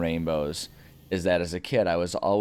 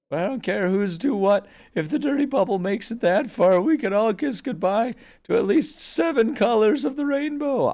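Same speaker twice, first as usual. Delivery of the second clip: put-on panicked old guy voice; 7 dB louder than usual. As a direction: quaver it out like a panicky old hero. Cartoon character voice.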